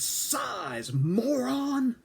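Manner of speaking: rising to an irritated shout; impatient yell. Retold or imitated. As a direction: snap at the slow recruit. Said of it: "And he said".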